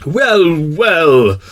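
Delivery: high, drawn-out royal hesitation; flustered king. A narrator's reading. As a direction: stall as a pompous king. King replies, put out.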